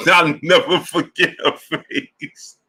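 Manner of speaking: high, slow words broken by laughter; laughing while talking. Real, not acted. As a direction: laugh right through the sentence. Laughing while recalling the moment.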